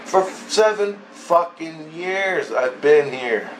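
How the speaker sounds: slow, weighted "seven fucking years"; exasperated emphasis. Retold or imitated. Man protesting the accusation, "seven fucking years" slow and exasperated.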